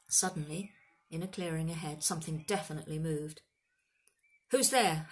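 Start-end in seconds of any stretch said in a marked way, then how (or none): 4.5-5.1 loud, higher call into the dark; called challenge. A narrator's reading. Hagrid challenges an unseen intruder, loud call. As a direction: challenge whoever is hiding out there. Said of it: "Hagrid called".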